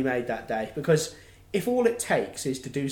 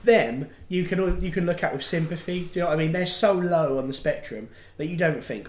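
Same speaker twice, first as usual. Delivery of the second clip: normal talking voice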